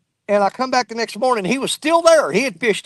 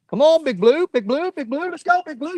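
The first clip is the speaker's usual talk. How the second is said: cheering on the fish; 6 semitones higher than usual. Real, not acted.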